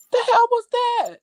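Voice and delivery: very high startled squeal of a question; re-enacted alarm. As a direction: replay the fright way up high.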